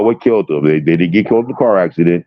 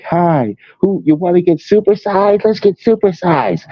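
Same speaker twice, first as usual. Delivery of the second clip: high, bubbly mock influencer pitch, slowing on the last line; 12 semitones higher than usual. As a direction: ham up a cheery food vlogger pushing supersized meals. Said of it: "the next person will be like".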